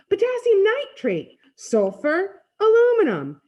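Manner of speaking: high, loud calling-out; excited, high-pitched list; exultant shouting. A narrator's reading. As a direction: shout each name as it bursts. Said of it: "Freak keeps on shouting out the names".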